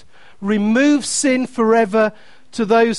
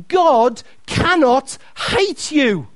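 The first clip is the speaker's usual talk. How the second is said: slow, deliberate preacher emphasis; slow and weighty; slower than usual. Shown as a demonstration. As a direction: lay each word down slowly. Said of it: "Please hear this".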